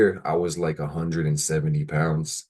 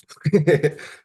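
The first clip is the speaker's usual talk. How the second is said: high squeaky laugh; 12 semitones higher, 6 dB louder than usual. Real, not acted. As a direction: burst into a high giggle. Laughs after shout out.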